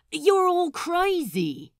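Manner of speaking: high, loud yell; outraged shout. A narrator's reading. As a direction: explode at the whole table. Pirate exploding at crew.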